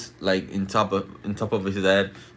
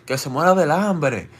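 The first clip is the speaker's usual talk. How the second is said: mild mock shout, pitched up; slightly raised, higher retold yell; retold Spanish shouting; 5 semitones higher, 3 dB louder than usual. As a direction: lightly imitate friends yelling in Spanish. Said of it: "They were shouting in Spanish".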